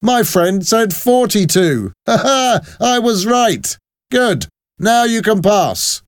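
high, gleeful soldier breaking into "ha ha"; boisterous, high-pitched soldier; laughing soldier. A narrator's reading. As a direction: crow triumphantly with a laugh. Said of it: "the soldier laughed".

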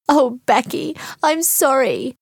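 high-pitched, distressed apology; upset apology. A narrator's reading. Kate apologizes to best friend Becky, upset.

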